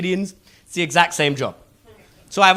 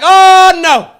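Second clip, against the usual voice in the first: loud, high "oh, no"; emphatic refusal; 12 semitones higher, 14 dB louder than usual. Retold or imitated. Comedian acting his own loud refusal to the salesman. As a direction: shut him down loudly. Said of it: "I'm like".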